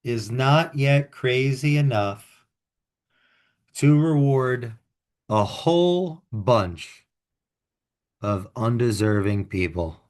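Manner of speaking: slow, deliberate epigraph with long pauses; quote reading. Acted. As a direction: read out the quote grandly, phrase by phrase. Reads out an opening quote.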